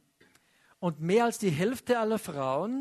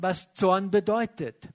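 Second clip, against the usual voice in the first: usual speaking voice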